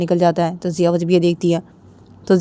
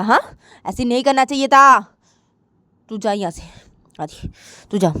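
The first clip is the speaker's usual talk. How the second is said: sharp, louder, ordering him out; angry girl; 2 semitones higher, 5 dB louder than usual. Acted. Jiya telling Adi to get out, angry. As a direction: throw him out of the room.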